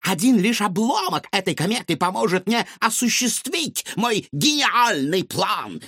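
high, gloating mad scientist. A narrator's reading. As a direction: boast with manic pride. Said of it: "сказал доктор Финдершлотц".

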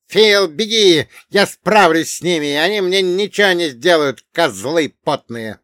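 high, loud defiant cry; dwarf's shouting. Acted. Captured dwarf urging Phil to flee, high shouting. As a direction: holler bravely to warn a friend. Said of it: "кричал карлик".

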